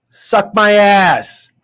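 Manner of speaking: slow, loud gloat. Real, not acted. Gloating at doubters after his correct score, slow and loud. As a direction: drag it out, taunting and loud.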